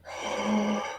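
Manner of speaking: acted sharp intake of alarm; drawn-in breath of mock panic; panicked gasp. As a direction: gasp as if disaster is imminent. Acting out urgent bathroom panic.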